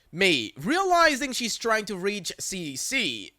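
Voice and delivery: ordinary delivery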